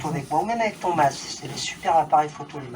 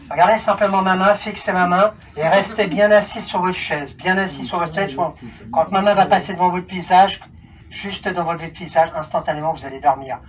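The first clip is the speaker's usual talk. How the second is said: firm, louder hypnotist voice, slowing toward sleep; hypnotic command; 2 semitones higher, 6 dB louder than usual. Acted. Hypnotist to volunteers on stage, firm commanding induction. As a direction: command them to fix the hand and sleep.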